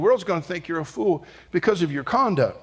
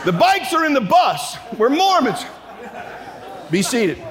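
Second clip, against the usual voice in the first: mock announcement; 10 semitones higher than usual. Students to onlookers on the overpass, high mock shout. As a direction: call it out to a crowd, hammed-up and high. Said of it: "we go".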